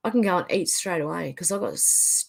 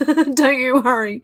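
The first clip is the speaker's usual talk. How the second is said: laughing; 5 semitones higher, 4 dB louder, slower than usual. Real, not acted. Laughing reassurance.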